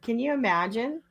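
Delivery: ordinary talk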